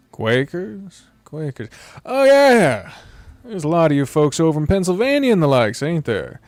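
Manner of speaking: puzzled, then folksy recognition; folksy traveller. Acted.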